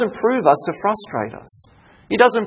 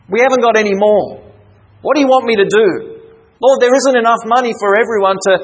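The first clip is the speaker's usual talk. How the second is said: higher, exasperated plea to the Lord; frustrated; 4 semitones higher, 3 dB louder than usual. Acted. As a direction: protest helplessly, pitch raised. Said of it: "you can see his frustration".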